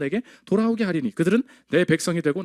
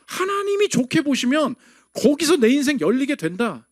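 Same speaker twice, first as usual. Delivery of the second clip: ordinary delivery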